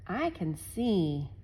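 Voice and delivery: ordinary delivery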